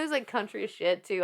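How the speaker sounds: ordinary talk, nothing marked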